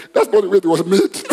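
punchline tipping into laughter; amused, laughing on the words. Real, not acted. Preacher on how a phone was made, laughing.

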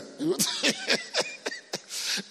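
high-pitched giggling laugh; a long, high burst of giggles. Real, not acted. Preacher tickled by his own joke, high giggle.

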